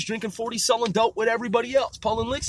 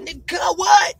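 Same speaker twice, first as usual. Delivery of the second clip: much higher, louder challenging "what?"; higher, louder mock Crip challenge; mock defiant shout; 10 semitones higher, 7 dB louder, slower than usual. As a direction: bark it like a cocky little dude. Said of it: "He'd be like".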